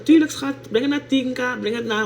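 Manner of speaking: bright on-stream hype; excited yes. Real, not acted. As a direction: cheer it out to the viewers. Enthusiastic reply about likes.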